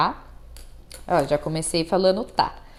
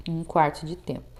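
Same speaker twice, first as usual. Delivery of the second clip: softer, lower echo of the answer; quiet repeat; 5 semitones lower, 6 dB quieter than usual. Shown as a demonstration. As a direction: say it again softly, letting it fall. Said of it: "qual que é a duração da semicolcheia?". (repetição suave e mais baixa)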